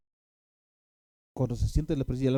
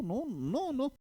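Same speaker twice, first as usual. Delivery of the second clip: high, soft drawn-out refusal, much higher and softer; refusing the Lord; 11 semitones higher, 5 dB quieter, much slower than usual. Retold or imitated. Benny Hinn turning down the Holy Spirit, high soft refusal. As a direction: shake it off with a high small no. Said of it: "me estaba diciendo a mí".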